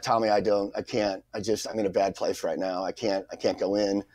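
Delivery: low, soft, defeated mumble; imitated dejection. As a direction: play a friend too down to go on. Dejected friend refuses to go in.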